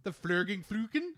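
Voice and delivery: lightly accented German mock word; hint of a German accent. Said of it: "how my German accent goes in this".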